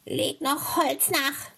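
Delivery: high, slow witch order; drawn-out, high-pitched witch command; witch's command. A narrator's reading. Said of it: "befahl ihr die Hexe".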